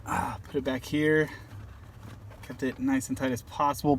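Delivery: normal talking voice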